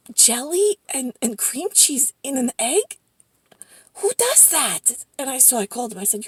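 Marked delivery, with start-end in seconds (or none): 0.1-5.0 high, incredulous questioning; softly aghast, voice rising high; appalled disbelief. Real, not acted. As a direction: question it in disbelief, pitch climbing. Appalled at eggs with jelly.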